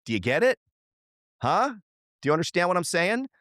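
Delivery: high, fast, pressing questions; urgent insistence. Real, not acted. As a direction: make them get it. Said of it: "pressing listener, high pitch".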